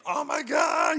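high, loud scream of alarm; mock panicked shout. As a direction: yell like a panicking comedy character. Acting out character attacked by bees.

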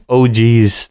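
mock Minnesota oh geez; a light Fargo oh geez; Midwest oh geez. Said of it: "So they kept just saying".

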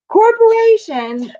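high, drawn-out mock cheer; sarcastic slogan. Real, not acted. Fans criticizing a huge corporation, sarcastic. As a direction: stretch it out like a sickly slogan.

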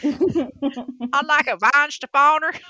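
hammed-up Kentucky drawl on a German beer name; Kentucky-accented German. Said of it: "pardon my occasional German pronunciations and my Kentucky accent".

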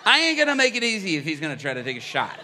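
fast, loud, laughing defiance; defiant laugh. Retold or imitated. Pastor recalling dodging the gunman, fast loud laughing defiance. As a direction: rush it with a laughing dare.

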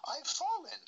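put-on high, whispered English-accented ghost voice; a far-off English ghost calling out faintly. Ghost boy in the footage speaks, high whispered English accent. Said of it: "he has an English accent".

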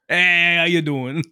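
light mock Brooklyn accent greeting; a hint of a Brooklyn tough guy. Imitating Spider-Man's put-on Brooklyn accent, light Brooklyn accent. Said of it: "he puts on that, uh, Brooklyn accent".

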